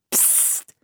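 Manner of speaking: zischendes Flüstern; psst. A narrator's reading. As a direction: Anne zum Schweigen bringen. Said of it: "machte Philipp". (leises Psst)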